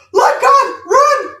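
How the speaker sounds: very high, loud, slow Jamaican shout; panicked shout. Retold or imitated. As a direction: yell it in terror in a Jamaican accent. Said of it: "Let God is like a Jamaican, you know, exclamation".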